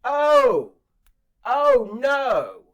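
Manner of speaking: high, loud mock "oh no"; mock alarm. Narrator reacting as Heather screams at the kids, loud high alarm. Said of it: "I remember I went".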